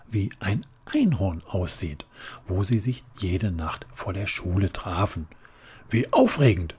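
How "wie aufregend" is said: loud, higher excited shout. A narrator's reading. Flora reacts to the news with an excited shout. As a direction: yell it with delight. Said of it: "schrie Flora".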